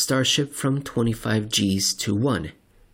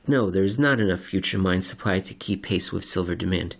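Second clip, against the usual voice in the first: normal talking voice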